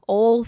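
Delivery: "ole" for oil, in one syllable; Southern slip. Retold or imitated. Actor catching her own slip, "ole" as one Southern syllable. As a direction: let "ole" slip out, rhyming with hole. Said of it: "I kind of have fallen back into".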